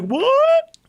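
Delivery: very high rising squawk; exaggerated incredulous "what"; mock shocked disbelief. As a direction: stretch it up high in outraged surprise. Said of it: "People were like".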